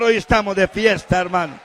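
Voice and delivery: loud preaching shout; HOY stressed and falling; festive call. Acted. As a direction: proclaim the celebration loudly.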